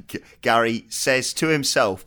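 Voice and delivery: usual speaking voice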